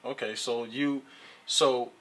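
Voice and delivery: usual speaking voice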